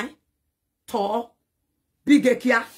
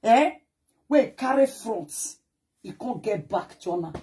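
ordinary delivery

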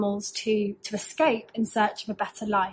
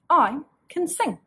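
lightly voiced chicken; 6 semitones higher than usual. Chicken's voice in story.

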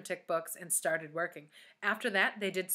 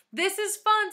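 gleeful shout; 14 semitones higher, 9 dB louder than usual. A narrator's reading. Myron gleeful.

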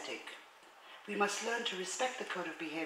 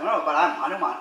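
proud Hanuman; 10 dB louder than usual. Retold or imitated. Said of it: "he's like".

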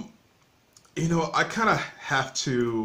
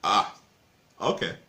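normal talking voice

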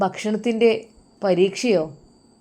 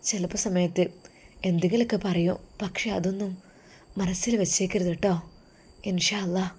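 husband quietly comforting his wife before going abroad; tender husband; 4 dB quieter than usual. Acted. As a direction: soothe her gently before he leaves.

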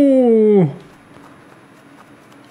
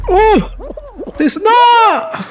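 alarmed yelling; 10 semitones higher, much slower than usual. Real, not acted. Sudden danger in game.

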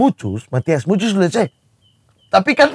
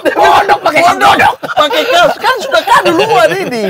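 high, loud through laughter; laughing back at the teasing; laughing protest; 10 semitones higher, 5 dB louder than usual. Real, not acted. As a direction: defend it while laughing.

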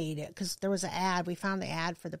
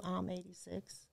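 puzzled quiet quote; 10 dB quieter, slower than usual. Retold or imitated. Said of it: "is that something new".

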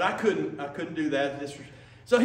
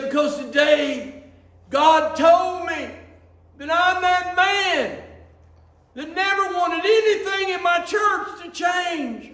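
put-on an old man breaking open, crying it out; retelling an old man's confession as a passionate shout; fervent cry; 11 semitones higher, 5 dB louder than usual. Old man's tearful confession, retold.

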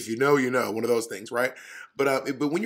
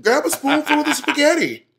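high, loud teasing hype; mock pushy friend; 11 semitones higher, 6 dB louder than usual. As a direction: egg them on in a squealing mock voice. Mocking pushy companion.

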